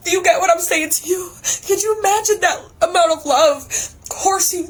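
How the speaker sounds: real tears breaking through; crying. Real, not acted. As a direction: let the tears break in as you say it. Overwhelmed describing divine love.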